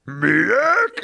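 high, strained puzzled monster voice; troll. Acted. Troll questions Ernest's weapon, high baffled creature voice. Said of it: "the troll goes".

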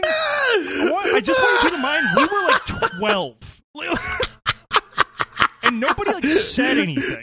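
high, loud, cracking with laughter; laughing disbelief. Real, not acted. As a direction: squeal through laughter.

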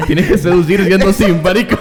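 laughing through the words; words breaking into laughter. Real, not acted. Laughing while explaining.

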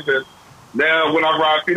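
usual speaking voice

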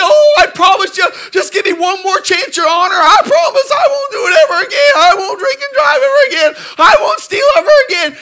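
loud, high crying plea; begging judge. Retold or imitated. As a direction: sob and beg the judge for mercy.

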